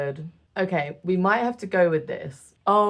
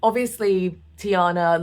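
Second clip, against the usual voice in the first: usual speaking voice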